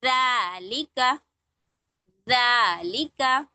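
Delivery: ज़ालिका read very slowly, syllable by syllable; slow recitation. Shown as a demonstration. Teacher slowly modelling a word for the class. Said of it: "पढ़ के देखिए".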